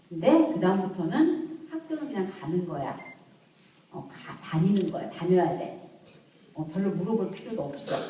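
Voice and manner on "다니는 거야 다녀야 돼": normal talking voice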